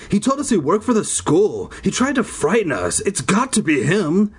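high, excited character voice; acted animated accusation; insistent. A narrator's reading. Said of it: "Zeke insisted".

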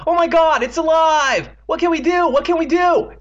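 very high, loud panicked wailing; terrified screaming. A narrator's reading. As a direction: shriek in hysterical terror. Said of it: "his own terrified voice".

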